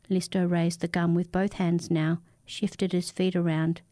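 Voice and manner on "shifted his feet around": ordinary delivery, nothing marked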